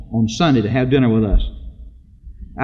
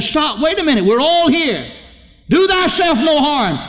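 urgent call; 12 semitones higher than usual. Acted. Paul cries out to jailer.

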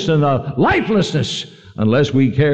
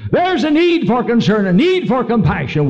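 higher, lifted sermon proclamation; rising preacher voice; 8 semitones higher than usual. Acted. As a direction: proclaim the need to the church. Sermon point raised.

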